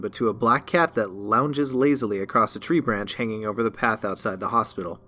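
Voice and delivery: usual speaking voice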